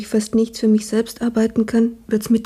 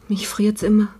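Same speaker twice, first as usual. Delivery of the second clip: shivering weak whisper. Acted. Sick maid, freezing in bed.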